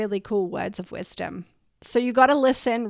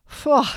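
loud exhaled phew; 5 semitones higher, 7 dB louder than usual. Real, not acted. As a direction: blow out a big relieved phew.

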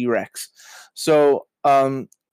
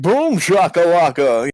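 loud, higher hype announcer shout; NBA Jam announcer; 4 semitones higher, 5 dB louder, slower than usual. Retold or imitated. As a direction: yell it like an arcade announcer. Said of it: "the N B A Jam guy".

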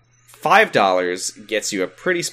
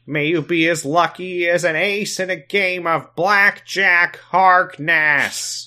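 high, loud, slow blessing; Sam voice; 9 semitones higher, 4 dB louder, much slower than usual. Acted. Host leading the congregation in a prayer, hammed-up character voice. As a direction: intone it grandly, stretching each word. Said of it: "in Sam voice, please".

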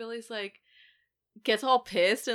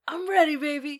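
a touch of hyped-up Stu, higher; imitating Stu; 8 semitones higher, 5 dB louder than usual. Podcaster quoting a hyped Stu before the stab. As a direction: psych yourself up like Stu. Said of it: "I love, like, his".

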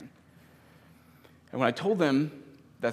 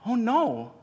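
put-on high, loud child's dismay; imitated gasping son; 12 semitones higher, 7 dB louder than usual. Son hearing the baby died, dismayed. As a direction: imitate a heartbroken little boy. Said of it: "he, he gasped".

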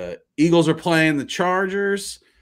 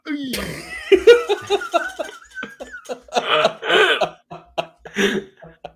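loud high laugh; 14 semitones higher, 3 dB louder than usual. Real, not acted. Laughing at the accent.

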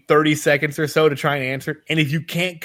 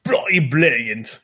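slow, hammed-up British accent; mock British accent; 3 semitones lower, slower than usual. Co-host echoing his friend's praise, mock British accent. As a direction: echo it in exaggerated British. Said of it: "you got a permit to be that British".

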